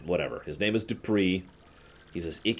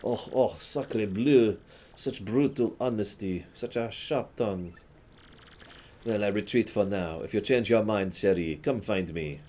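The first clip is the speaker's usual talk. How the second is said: lightly hammed-up wounded French charmer; mock-hurt French lover bowing out, slow; French accent; 2 semitones higher than usual. Rejected Dupree retreating from the partner with a light French accent.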